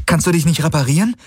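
fast, high-pitched anxious query; urgent question. A narrator's reading. Kirai asks the ship about repairs, urgent. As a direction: hurry the question out, pitch lifted.

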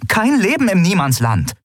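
high, incredulous outcries; indignant exclamations. A narrator's reading. Officers react to the report with indignant disbelief. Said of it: "Empörte und oder verwirrte Ausrufe".

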